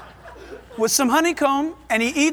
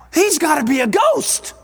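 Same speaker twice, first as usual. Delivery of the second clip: higher, exclaiming in mock shock; startled disbelief; 4 semitones higher, 4 dB louder than usual. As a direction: act out a startled onlooker's disbelief. Voices the startled disciples.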